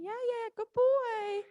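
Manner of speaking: slow high praise for a horse; praising horse. Real, not acted.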